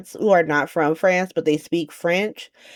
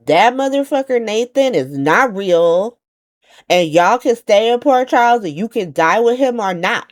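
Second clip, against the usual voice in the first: loud, raised-pitch mock outrage; emphatic rant; 6 semitones higher, 5 dB louder than usual. Anna to Felicia once they are alone, emphatic rant. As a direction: hammer it out as an insistent warning.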